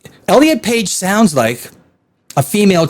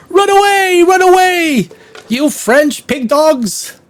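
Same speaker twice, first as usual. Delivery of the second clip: Monty Python quote; 10 semitones higher, slower than usual. Retold or imitated. Quoting Monty Python knights fleeing, high mock panic cry. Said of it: "If you're a Monty Python fan".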